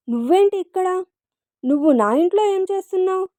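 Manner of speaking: high-pitched, incredulous questions; startled questioning. A narrator's reading. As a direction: ask as if suddenly jolted sober. Sobered man finds her at home.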